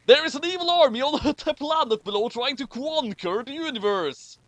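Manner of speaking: over-the-top trailer narrator; dramatic narrator voice. Acted. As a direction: announce doom like a trailer voice. Rereading the line dramatically.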